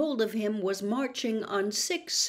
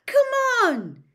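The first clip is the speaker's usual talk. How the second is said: much higher, louder eager shout; excited call; 8 dB louder than usual. A narrator's reading. Ron urging Harry toward the car, excited shout. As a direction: urge him on joyfully.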